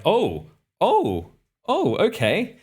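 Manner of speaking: high, swooping "oh"s; retold uneasy surprise, pitch leaping up; dawning realization. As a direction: let each "oh" swoop as it sinks in. Dawning alarm at being sent back.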